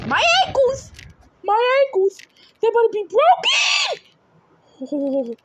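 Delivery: high, loud yelp for the ankles; mock pained yell. Real, not acted. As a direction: wail it like it hurts, half joking. Character falls, mock pain.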